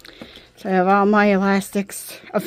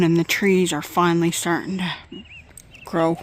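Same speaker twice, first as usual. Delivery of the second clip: ordinary delivery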